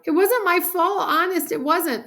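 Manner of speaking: put-on high, loud girlish protest; upset kid's wail; crying out defensively. A narrator's reading. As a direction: plead innocence in a panic. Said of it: "Sheila cried".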